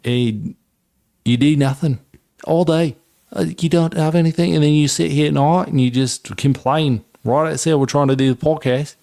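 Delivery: mock Aussie rant at a whining dog; Australian accent. Telling off his crying dog, mock Australian accent rant. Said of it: "All right, that was bad".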